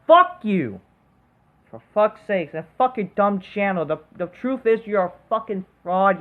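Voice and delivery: ordinary talk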